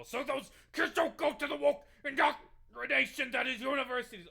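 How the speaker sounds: high, very loud mock tirade; sudden ranting yell. Streamer mimicking candidate raging at universities, shrieking mock tirade. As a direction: erupt into a hammed-up high-pitched rant.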